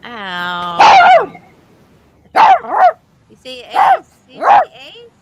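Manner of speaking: high squealing dog talk. Real, not acted. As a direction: squeal at the puppy. Talking to the dog.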